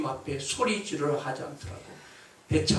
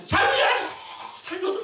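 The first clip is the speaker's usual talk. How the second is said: desperate cry for help. Acted. Drowning man calls for help.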